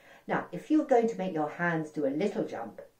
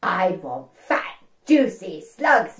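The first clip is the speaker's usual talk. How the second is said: very slow, drawn-out and louder; savouring slugs; 6 dB louder, much slower than usual. Acted. Froggy dreaming of his birthday treat, slow and relishing. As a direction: linger greedily on each word.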